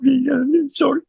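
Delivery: suddenly very high, squeaky choked-up voice; voice going up. Shown as a demonstration. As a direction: show your voice shooting way up as if about to cry. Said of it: "my voice goes up".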